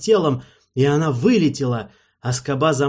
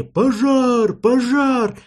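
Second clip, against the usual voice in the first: much higher alarmed shout; yelling; 11 semitones higher, 4 dB louder, much slower than usual. Acted. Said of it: "Люди орали".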